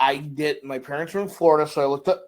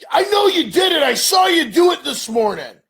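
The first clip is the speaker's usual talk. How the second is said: high, loud accusing yell; hammed-up caller shouting accusations; recorded caller yelling at dad; angry shout; 11 semitones higher, 7 dB louder than usual.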